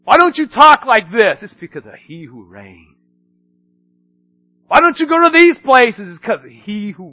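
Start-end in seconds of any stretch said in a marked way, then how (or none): 0.0-1.4 loud mock challenge. Mock question to believer. 1.4-3.0 quiet answer. Acted. Soft answer after loud question. 4.7-6.1 loud mock challenge. Mock question to believer.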